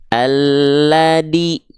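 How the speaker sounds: ALLADHI said "alladi" with a hard D; dal replacing zal. Shown as a demonstration. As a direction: slowly say "alladhi" with a plain d stop for the dh. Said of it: "Banyak orang melafalkannya gini". ("alladhi", zal dibaca menjadi dal)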